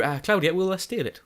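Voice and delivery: usual speaking voice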